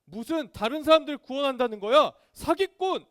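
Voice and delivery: high, loud jeering heckler; acted sneering denunciation; scornful accusation. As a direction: hurl accusations, building to a shouted insult. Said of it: "이러면서 막 예수님을 손가락질했어요".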